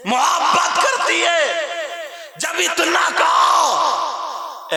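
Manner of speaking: preacher's full shout. Acted.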